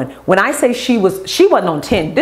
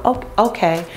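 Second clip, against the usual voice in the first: normal talking voice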